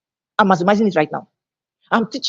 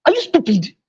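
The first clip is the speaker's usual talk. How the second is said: higher-pitched, cutting question; demonstrated offended-making jab, pitched up; 6 semitones higher than usual. Shown as a demonstration. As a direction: throw it as an insult. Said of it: "the person will get offended".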